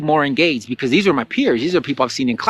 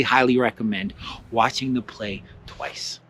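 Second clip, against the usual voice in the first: ordinary talk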